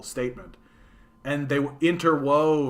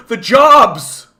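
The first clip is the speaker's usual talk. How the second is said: much higher, much louder mock outrage; mock outraged cry; 10 semitones higher, 15 dB louder than usual. Pretend viewer mourning Death Star jobs, shouted mock outrage. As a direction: cry out over the lost jobs, hammed-up. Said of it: "nobody's like".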